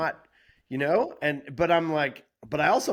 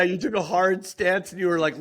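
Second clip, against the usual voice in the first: ordinary talk, nothing marked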